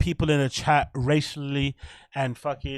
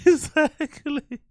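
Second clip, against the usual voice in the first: laughing; 13 semitones higher, much slower than usual. Real, not acted. Laughing hard at chat.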